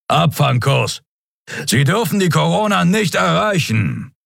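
high, urgent captain's command; commanding order. A narrator's reading. Captain orders crew to intercept, commanding.

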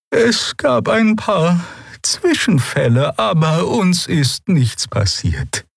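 calm them down gently and slowly; reassuring. Acted. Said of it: "versicherte Xea".

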